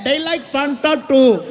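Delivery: slow, drawn-out mock Indian accent; imitated Indian crowd yelling. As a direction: call out helpfully in a put-on Indian accent. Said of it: "They're yelling".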